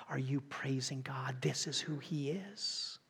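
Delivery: hushed reverent whisper. Acted. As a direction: whisper the question to the church. Reverent questioning.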